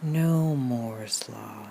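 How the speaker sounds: very slow, low, softened "no Moore's Law"; hushed, drawn-out meditative correction; clarifying mantra. Pretend. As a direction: draw out each word of "no Moore's Law". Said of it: "but I'm saying".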